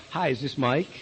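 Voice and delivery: low, soft opener on a cold call; quiet, low-key phone greeting; phone technique. Shown as a demonstration. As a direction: open the call gently, voice dropped. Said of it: "That's technique, right?".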